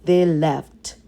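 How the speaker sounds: plain reading voice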